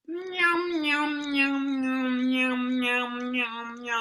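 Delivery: high squeaky meowing like a cat; cat meows. Shown as a demonstration. Coach demos the cat part, high meowing. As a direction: meow high like a kitten. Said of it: "five seconds of meowing".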